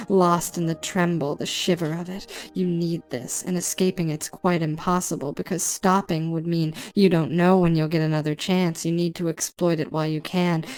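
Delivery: ordinary delivery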